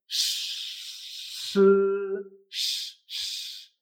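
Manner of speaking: long unvoiced SH, as if hushing someone; shushing sh. Shown as a demonstration. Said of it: "callar a alguien".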